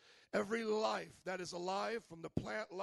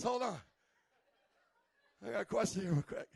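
laughing through the interruption; Thomas chuckling; 3 dB louder than usual. Retold or imitated. Thomas interrupting Jesus, chuckling. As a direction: chuckle while raising a hand, real quick. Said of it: "He's that guy in the class".

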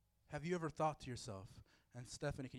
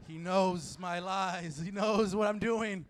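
drawn-out, building to louder mock alarm; mock panicked realization; 7 dB louder, much slower than usual. Preacher mimics a listener whose lies are known, hammed-up alarm. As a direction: stretch it slowly like someone found out.

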